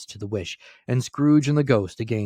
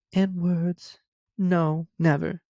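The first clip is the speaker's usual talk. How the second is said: much higher woman's voice; the girl releases young Scrooge, higher voice; grieving young woman; 9 semitones higher than usual. A narrator's reading. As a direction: voice the girl sorrowfully, pitched high. Girl's voice.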